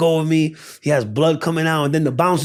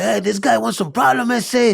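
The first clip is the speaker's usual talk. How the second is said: higher, lightly acted Mexican dude voice; 8 semitones higher than usual. Mexican dude challenges the attacker, acted tough talk. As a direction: puff up as a challenging little Mexican guy. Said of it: "the little Mexican dude's like".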